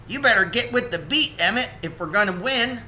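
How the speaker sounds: a lightly voiced eager beaver; Charlie urging. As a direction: hurry him back onto the beat.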